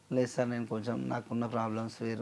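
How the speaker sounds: mild, soft deferential plea; polite request. Acted. Musician pleading his case to a producer, politely. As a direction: speak a little humbly and softly. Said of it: "అని మర్యాదగా చెప్తే".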